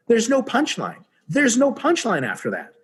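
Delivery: ordinary talk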